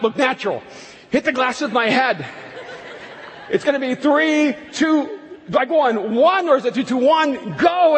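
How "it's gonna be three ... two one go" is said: high, loud, flustered rush through the count; losing track of the numbers in a panic; loud, anxious countdown confusion; panicked counting. Retold or imitated.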